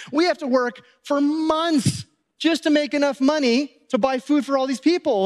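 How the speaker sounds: high-pitched, exasperated objection; protesting disciples. Acted. Disciples objecting to feeding the crowd, exasperated.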